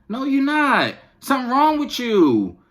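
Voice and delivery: high and loud, fed up; exasperated scolding. Real, not acted. Mother scolding her daughter for faking hunger, high and loud.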